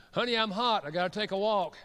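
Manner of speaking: loud, high, urgent call; heated announcement. Retold or imitated. Husband warning wife he's losing his temper, acted heated shout. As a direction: imitate a husband blurting it out before he blows.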